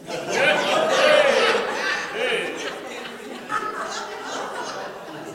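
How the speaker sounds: big hearty burst of laughter. Real, not acted. Congregation laughing at joke.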